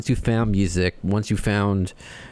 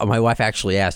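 usual speaking voice

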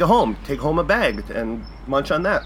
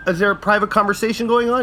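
mock teacher scolding; 8 semitones higher than usual. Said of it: "Kevin McDonald's like".

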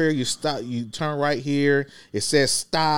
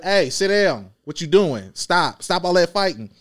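put-on raised, higher voice scolding the kids; yelling at kids; 6 semitones higher than usual. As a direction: snap at rowdy kids from the driver's seat. Driver yelling at kids on bus.

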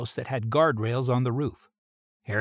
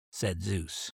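ordinary delivery, nothing marked

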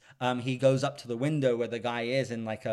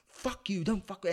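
higher-pitched imitation of an angry boyfriend snapping back; mock angry outburst; 7 semitones higher, 3 dB quieter than usual. Retelling the breakup fight to a friend, mock angry outburst. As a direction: fling it back at her, mock furious. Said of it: "And he's like".

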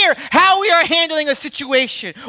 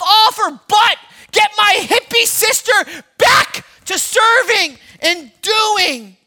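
Martha's angry complaint; 6 semitones higher, slower than usual. Acted.

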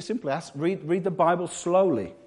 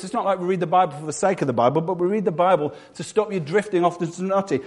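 ordinary talk, nothing marked

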